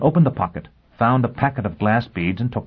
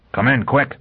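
higher, urgent call; urgent summons; 6 semitones higher than usual. Acted. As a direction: hurry someone inside.

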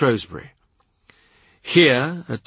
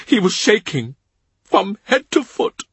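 much higher grieving servant's voice; tearful Cavendish; 9 semitones higher than usual. A narrator's reading. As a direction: lift it high like a tearful old retainer. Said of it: "He cries as he talks".

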